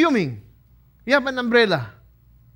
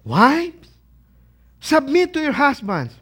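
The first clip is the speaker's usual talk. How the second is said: mock displeased, raised voice; wife's displeased reading; 4 semitones higher, 4 dB louder, slower than usual. Wife reaching the verse on submitting, displeased. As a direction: read it out as an unwelcome order.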